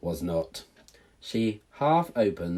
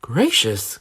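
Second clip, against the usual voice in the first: louder startled gasp of surprise; louder old lady exclamation; startled cry; 9 dB louder than usual. Acted. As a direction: cry out in shock. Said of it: "cried the old lady".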